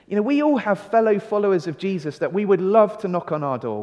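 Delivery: ordinary delivery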